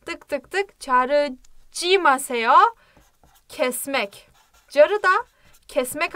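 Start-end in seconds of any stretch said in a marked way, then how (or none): 0.1-2.5 çarıcimaseyo stretched out very slowly, each syllable apart. Shown as a demonstration. Teacher writing the word out while saying it slowly. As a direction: spell out çarıcimaseyo slowly for the class. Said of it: "Yazayım şöyle".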